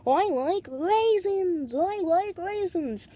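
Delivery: high, slow, looping French-British voice; French-British accent. Retold or imitated. Repeating the voice stuck in his head, high French-British accent. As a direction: chant it slowly in a fake French-British accent. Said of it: "in some French kind of British accent".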